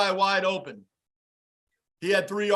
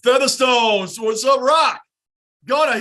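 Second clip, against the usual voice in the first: shouted greeting; 3 semitones higher, 7 dB louder than usual. Real, not acted. Greeting chat members by name.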